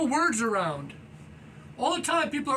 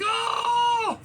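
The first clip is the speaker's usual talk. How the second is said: mock freak-out; 10 semitones higher, 4 dB louder than usual. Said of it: "So they get all crazy on me".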